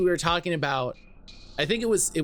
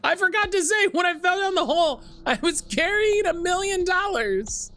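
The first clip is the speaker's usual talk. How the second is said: put-on boy bait offer; 9 semitones higher than usual. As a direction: lure them down with a bribe. Trapped boy bribes heroes.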